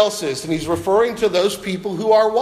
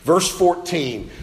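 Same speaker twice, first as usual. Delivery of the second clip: ordinary talk, nothing marked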